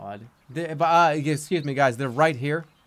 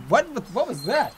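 surprised; 9 semitones higher than usual. Real, not acted. Surprised by cutscene.

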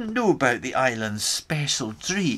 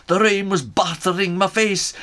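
plain reading voice

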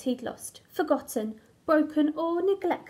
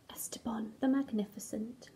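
breathy grand whisper of the name; hushed, proud signature; whispered sign-off; 5 semitones lower, 10 dB quieter than usual. Acted. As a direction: whisper the grand title like a secret. Crayon signs off grandly in whisper.

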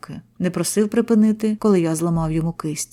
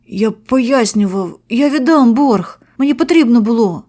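higher, louder, slow pleading; pleading victim; 4 semitones higher, 6 dB louder than usual. A narrator's reading. Victim explaining to the robbers, pleading. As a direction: plead desperately for understanding.

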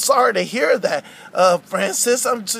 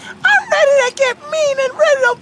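high-pitched worked-up puppet voice; indignant character rant; vengeful Frankie. Acted. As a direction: threaten revenge like a cartoon character. Frankie vows revenge.

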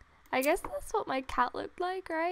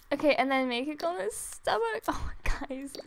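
ordinary talk

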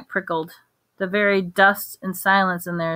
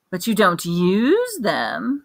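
slow, pointed reproach; sharply; much slower than usual. A narrator's reading. Wandmaker to Hagrid, a touch of sharp reproach. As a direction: press the accusation home. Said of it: "said Mister Ollivander sharply".

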